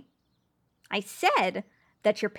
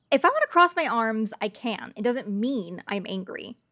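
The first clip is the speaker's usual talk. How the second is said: normal talking voice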